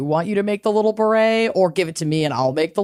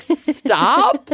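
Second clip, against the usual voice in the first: incredulous; 6 semitones higher than usual. Retold or imitated. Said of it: "And I was like".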